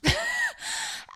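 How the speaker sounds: sudden high-pitched giggle; high squeaky laugh. Real, not acted. Laughing at what annoys her in recovery, squeaky giggle.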